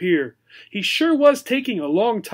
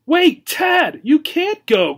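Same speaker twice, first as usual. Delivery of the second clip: much higher, louder pleading cry; desperate cry; 10 semitones higher, 6 dB louder, slower than usual. Acted. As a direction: beg him not to leave. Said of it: "cried Obi".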